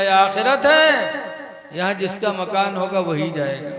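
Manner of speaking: loud, high stern rebuke; angel scolding. Acted. Angel shouting at Harun to stay out of paradise.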